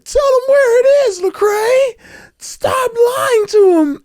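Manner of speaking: high, loud tearful pleading, slowing at the end; crying friend. Retold or imitated. A scared friend to [name], crying high and loud. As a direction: beg through tears. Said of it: "So he's crying".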